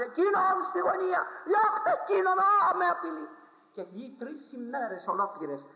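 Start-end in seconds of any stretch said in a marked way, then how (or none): 0.0-3.3 high, loud frightened pleading; terrified cry. Retold or imitated. Dying unbeliever crying out in terror, high and loud. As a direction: cry out in terror at a dark figure. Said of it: "και φώναζε".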